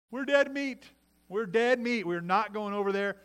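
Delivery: high, loud protest of doom; alarmed spies. Retold or imitated. Spies reporting back to the people, mock alarm.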